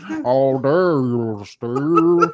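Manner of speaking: slow, indignant mock outrage; hammed-up how dare you; mock offended. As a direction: clutch your pearls, drawn out. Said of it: "I got this".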